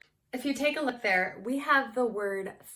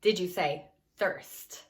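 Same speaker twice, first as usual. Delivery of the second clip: ordinary delivery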